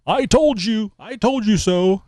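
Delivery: high, mocking told-you-so voice; Al Gore. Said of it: "Al Gore can stand there shaking his fist".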